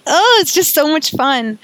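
high, loud gush of joy; delighted. Real, not acted. Podcaster gushing about embodying her new self, delighted. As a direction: still laughing, gush about it. Delighted exclamation after laughing.

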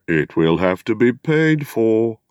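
slow, grumbling village gossip; a lightly voiced wary hobbit. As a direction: mutter it like a disapproving neighbour. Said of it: "they said".

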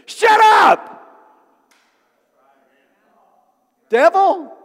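explosive high shout at the devil; shouted rebuke. Acted. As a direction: yell it full force, then pause before naming the enemy. Acted rebuke of the enemy.

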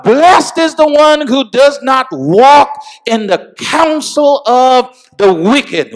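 climbing into full-force preaching; rising preacher's shout. Acted.

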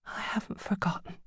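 frightened whisper. A narrator's reading. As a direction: whisper it afraid. Judy whispering.

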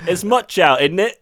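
put-on a goofy British-sounding accent; mock British accent. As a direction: lapses into a jokey cockney lilt. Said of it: "Why do I keep doing it".

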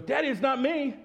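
put-on high innocent child denial; little son's voice. Child voice quoted.

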